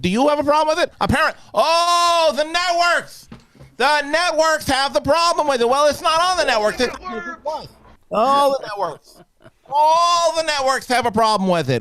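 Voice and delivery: high, indignant rant about the networks; hammed-up outrage at the networks; mock outrage. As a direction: rise up high in fake indignation.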